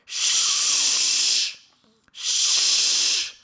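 long, loud shush; forceful "shh". Retold or imitated. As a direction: hush someone with a drawn-out hiss of air. Hushing a crying baby.

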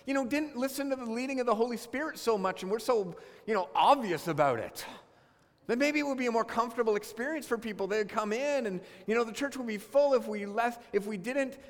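frustrated griping. Retold or imitated. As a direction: vent like a fed-up churchgoer. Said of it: "But I get so frustrated".

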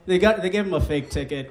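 ordinary delivery

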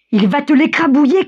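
high, alarmed cry from bystanders; shouting. A narrator's reading. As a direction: cry a warning, pitched well up. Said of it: "crie-t-on autour de lui". (cri d'alarme, voix haute)

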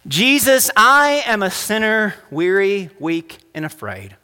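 slow, high, measured prayer for repeating; led prayer. Acted. Pastor leading the congregation in prayer, slow and measured. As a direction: lead the room line by line in prayer.

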